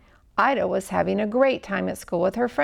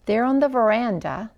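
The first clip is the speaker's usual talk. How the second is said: plain reading voice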